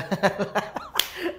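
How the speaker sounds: 1 laugh. Real, not acted. Teacher laughs during demo.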